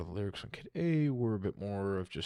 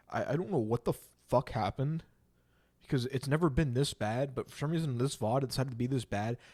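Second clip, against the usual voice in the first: frustrated; 6 semitones higher, 5 dB louder than usual. Real, not acted. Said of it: "I'm so mad".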